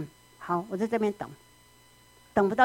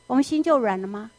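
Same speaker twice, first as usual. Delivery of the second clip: normal talking voice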